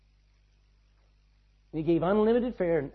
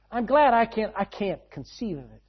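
usual speaking voice

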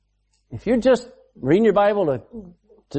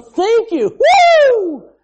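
exuberant thanks; 8 dB louder, much slower than usual. Acted. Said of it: "waking up and going".

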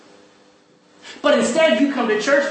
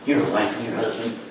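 usual speaking voice

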